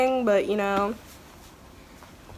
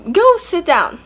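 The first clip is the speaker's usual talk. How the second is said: sharp call; 9 semitones higher, 7 dB louder, slower than usual. Real, not acted. Mother scolds child off camera.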